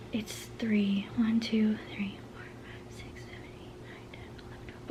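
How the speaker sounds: counting listings under her breath; whispered count. Real, not acted. Counting shop listings quietly to herself, whispered.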